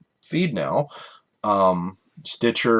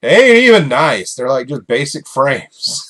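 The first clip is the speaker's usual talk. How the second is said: loud, high disbelieving shout; exasperated outburst; 5 semitones higher, 9 dB louder than usual. Retold or imitated. Telling listeners his reaction to the frame frenzy, loud exasperated shout. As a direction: yell it, baffled at the fuss. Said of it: "I'm just like".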